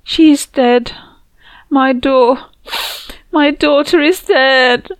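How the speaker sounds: high, loud, broken with grief; sobbing. Acted. Mother tells her friend her daughter died, sobbing. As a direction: force out the words through sobs. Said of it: "She was crying".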